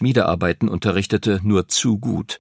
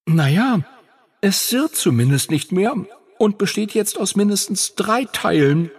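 higher dragon voice through a mouthful; with full cheeks; 9 semitones higher than usual. A narrator's reading. Phoenix to Henry, mouth full of the broken disc, higher. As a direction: talk around the chewed pieces. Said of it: "mit vollen Backen".

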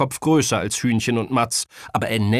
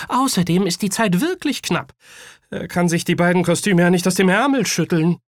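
put-on high, louder, insistent child voice; boy defending his father; 8 semitones higher, 6 dB louder than usual. A narrator's reading. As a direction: stand up for your dad as a kid. (Junge, der seinen Vater verteidigt)

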